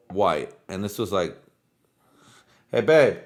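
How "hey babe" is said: real louder call to a partner; quick raised-voice summons. Real, not acted. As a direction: call out to get someone's attention. Calling to someone off mic.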